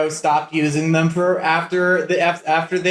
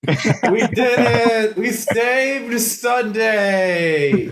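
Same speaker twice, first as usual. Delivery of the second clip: slow triumphant; 5 semitones higher, much slower than usual. Acted. Echoing the triumph.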